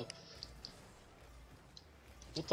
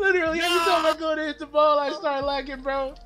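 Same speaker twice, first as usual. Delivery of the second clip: laughing; 10 semitones higher, 5 dB louder than usual. Real, not acted. Laughing at lag in match.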